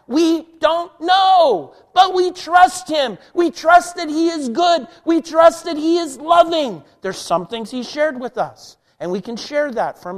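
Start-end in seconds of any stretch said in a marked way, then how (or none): 0.1-6.8 loud, high, slowed preaching cry; preaching shout. Real, not acted. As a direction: proclaim it at full force to the congregation. Preacher building to a climax.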